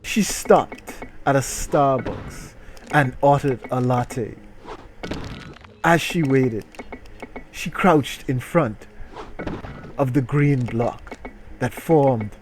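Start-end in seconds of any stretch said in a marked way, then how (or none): none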